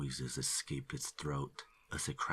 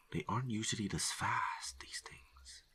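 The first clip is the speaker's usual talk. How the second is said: whisper; 7 semitones higher than usual. A narrator's reading. Narrator aside about deer.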